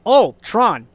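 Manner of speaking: normal talking voice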